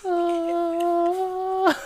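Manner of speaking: long high-pitched wail; dramatic mock wail. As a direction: melodramatic crying "ah", drawn out. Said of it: "so dramatic".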